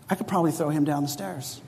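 softly voiced passing thought; quiet inner thought. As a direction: murmur the dark thought to yourself.

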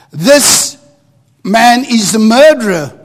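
ordinary talk

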